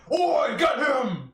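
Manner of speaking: high, loud, slow orc yell. Acted. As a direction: bellow like an orc chieftain. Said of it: "you hear the orc's voice like".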